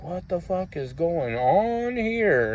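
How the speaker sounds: slow, drawn-out retold disbelief; dazed muttering to himself, acted out; stunned bewilderment. Walks off slowly, baffled by badge.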